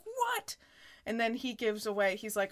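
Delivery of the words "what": high squeaky disbelieving what; shocked what. Retold or imitated. Ross reacts in retold quote.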